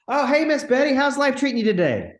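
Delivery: light up like she's your favorite neighbor; bubbly phone greeting to a stranger; best friend greeting. Shown as a demonstration. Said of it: "best friend approach demo".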